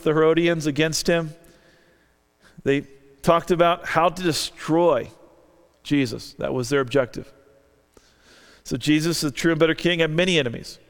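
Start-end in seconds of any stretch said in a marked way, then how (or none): none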